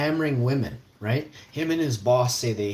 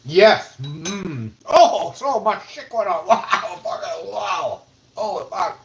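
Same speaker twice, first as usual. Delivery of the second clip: high, loud, laughing yelps; overwhelmed by the flavour; ecstatic outburst; 9 semitones higher, 10 dB louder, slower than usual. Real, not acted. Tastes very spicy sauce.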